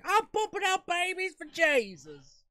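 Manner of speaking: hammed-up pious mother; zealous devotee. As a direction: squeal it like a proud believer. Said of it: "they're like".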